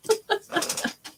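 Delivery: a short airy giggle; real giggle, almost silent; breathless high laugh. Real, not acted. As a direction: let a quick high laugh slip out. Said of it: "I'm just laughing because".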